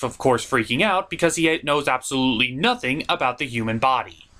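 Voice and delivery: ordinary talk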